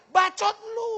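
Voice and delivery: high-pitched retort from the devil; high, drawn-out comic comeback; devil talking back. Acted. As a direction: play the devil answering back. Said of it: "Setannya bilang".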